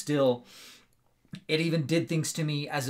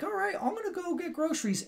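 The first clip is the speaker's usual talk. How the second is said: much higher, breezy mom voice; 3 dB quieter than usual. Retold or imitated. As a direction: play the unaware mother, light and high. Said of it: "The mom's like".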